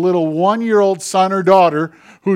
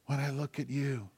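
soft voice of God; 6 dB quieter than usual. Retold or imitated. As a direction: speak softly like God to a child. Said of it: "God whispers to you and said".

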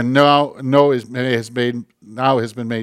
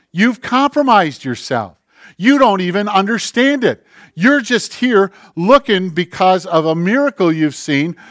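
louder, higher, driving sermon voice; fiery preaching; 5 semitones higher, 7 dB louder than usual. Acted. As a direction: press the crowd hard from the pulpit. Preaching at full force.

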